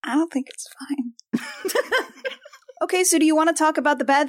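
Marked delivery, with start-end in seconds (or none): 0.0-1.4 whispering. Real, not acted. Whispered aside.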